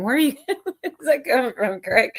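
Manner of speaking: laughing. Real, not acted. Laughing at odd remark.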